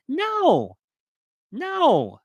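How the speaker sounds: high-pitched, indignant no; emphatic refusal. Real, not acted. Asked to pass as Osage on film, indignantly refusing. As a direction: refuse outright, pitch leaping up. Rejects hypothetical job offer.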